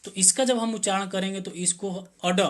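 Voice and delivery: ordinary talk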